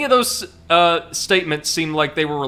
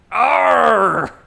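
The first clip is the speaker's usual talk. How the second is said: loud high battle cry; battle roar; 7 semitones higher, 9 dB louder than usual. Acted. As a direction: roar as the boss charges in. Villain attacks in boss fight.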